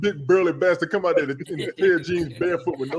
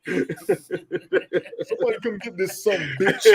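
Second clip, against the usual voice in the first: slow, softer laughing mock plea; drawn-out pretend cry for help; 5 dB quieter, much slower than usual. Friend plays the scared neighbor, slow laughing plea.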